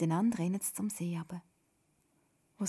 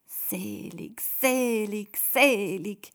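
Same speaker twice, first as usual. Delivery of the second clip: savouring one word, growing louder; contented, to herself; 2 semitones higher, 8 dB louder, much slower than usual. A narrator's reading. Toda repeating Jesus' word, contentedly to herself. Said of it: "sagt sie zufrieden vor sich hin".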